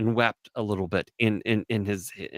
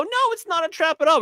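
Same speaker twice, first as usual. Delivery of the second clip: high, fast, sing-song mock reassurance; mocking women; 3 dB louder, faster than usual. A man mimics women denying it's a trap, high and fast. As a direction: squeal it fast like a fake promise. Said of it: "other women go".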